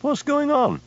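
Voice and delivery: shouted question. A narrator's reading. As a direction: shout it across the waterfront. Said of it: "shouted Tom".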